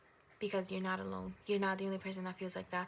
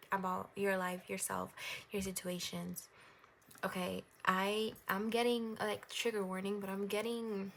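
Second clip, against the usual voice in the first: normal talking voice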